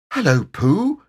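high, rising little greeting; high-pitched storybook piglet; small piglet voice. A narrator's reading. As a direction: pipe up as a very small animal.

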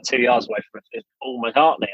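normal talking voice

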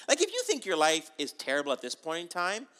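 ordinary talk, nothing marked

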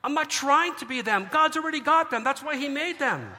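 high, loud, rising preacher's cry; preaching fervour. Real, not acted. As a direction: lift it up like a preacher at full force. Preacher builds to a climax.

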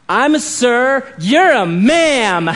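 put-on loud, slow childish answer; lightly voiced two-year-old; toddler's reply. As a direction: mimic the stubborn toddler.

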